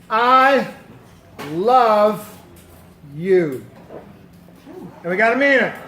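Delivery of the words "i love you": each word spaced out; slow spaced words. Shown as a demonstration. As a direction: give every word its own beat. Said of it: "Second three words".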